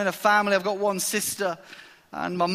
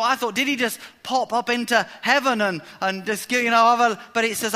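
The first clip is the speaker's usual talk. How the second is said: usual speaking voice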